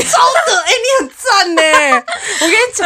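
high laughing squeal of praise; shrieking with laughter. Real, not acted. Praising her friend's cart trick, squealing with laughter. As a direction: crack up while cheering her on.

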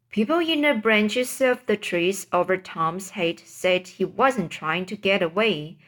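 ordinary delivery

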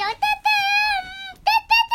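high, loud squeaky kitty call; cat voice. Pretend. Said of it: "后来这个猫咪说".

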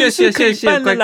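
高声夸张地安慰; 假装哄人的高声调; mock comforting. Acting the teachers comforting him. (扮老师高声哄他没事)

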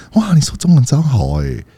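lightly voiced impressed local gushing; mock-gush over his Chinese; impressed praise. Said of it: "你说的中文很好的样子".

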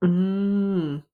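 ordinary talk

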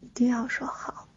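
put-on soft childish begging whisper; cutesy whiny pleading. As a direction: wheedle like a spoiled little kid. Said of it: "撒娇".

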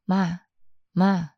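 ordinary talk, nothing marked

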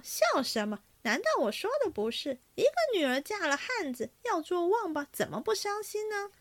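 high-pitched, lively self-justification; indignant protest. A narrator's reading. Oaf defends his verse to laughing guests, indignant and high. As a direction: argue back, pitch climbing as you go.

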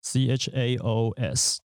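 slow letter-by-letter spelling; spelled out. Shown as a demonstration. Host spells out the tricky word for listeners, spelled slowly. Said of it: "因为它C H开头".

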